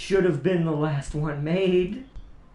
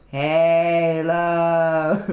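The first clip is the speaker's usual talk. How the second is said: one long, drawn-out chant; mock-worshipful sustained intoning; worshiping grunt; 2 semitones lower, 3 dB louder than usual. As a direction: stretch it out like a grunt adoring a sacred ring. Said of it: "the grunts go".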